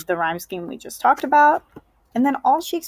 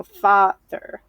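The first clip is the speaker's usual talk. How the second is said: "father" slowly, with FA stressed and ther light; drawn-out FA-ther, split in two; stress on first syllable; 4 dB louder than usual. Shown as a demonstration. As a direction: lean on the FA in "father". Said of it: "we're gonna be stressing the F A sound".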